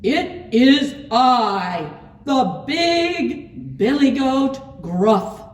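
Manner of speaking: big billy goat's loud voice. A narrator's reading. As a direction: proclaim it slowly at full volume. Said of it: "as loud as the troll's".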